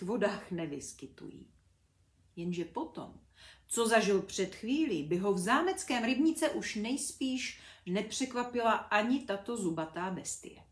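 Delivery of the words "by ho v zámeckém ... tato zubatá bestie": ordinary delivery, nothing marked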